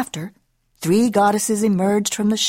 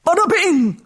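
loud, raised outburst from a mummy; moaning mummy king; 9 semitones higher, 6 dB louder than usual. A narrator's reading. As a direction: moan it out loudly like a disturbed mummy. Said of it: "moaned the king".

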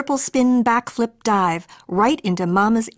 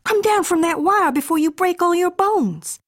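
worried mother; 9 semitones higher than usual. Acted. Worried mother calls to son.

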